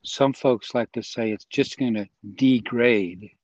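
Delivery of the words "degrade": degrade punched and set apart; stress on degrade. Real, not acted. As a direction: lean hard on the word degrade. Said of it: "I emphasize that word".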